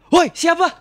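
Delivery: loud, high yell at the vent; startled shout. Acted. Girlfriend yelling at someone secretly taking photos, a loud startled shout. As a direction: shout out to catch the hidden photographer.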